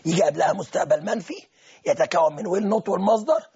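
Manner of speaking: ordinary talk, nothing marked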